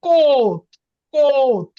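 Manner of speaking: কোট said loud with a clear ও; teacher demoing a stressed কোট. Shown as a demonstration. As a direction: hit the ও of কোট. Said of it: "loud কোনটা বা বেশি পরিষ্কার কোনটা?".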